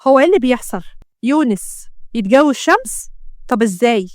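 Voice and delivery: ordinary delivery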